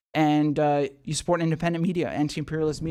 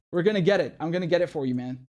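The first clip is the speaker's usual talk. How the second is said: usual speaking voice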